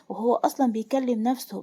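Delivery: plain reading voice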